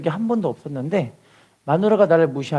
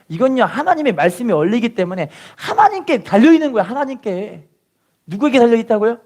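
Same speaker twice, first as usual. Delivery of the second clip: raised, insistent sermon voice; fervent preaching; 5 semitones higher than usual. Acted. Pastor exhorting the church, fervent preaching. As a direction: drive the point home to the congregation.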